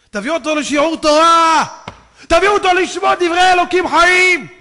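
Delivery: high, very loud preaching cry; impassioned appeal at full voice; shouted exhortation. Retold or imitated. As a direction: call it out to the whole crowd. Preacher acts God's urgent appeal.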